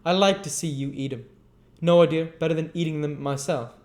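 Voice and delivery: put-on high, sweet coaxing girl; coaxing Charlotte. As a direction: offer it up sweetly to him. Charlotte doting on Noah.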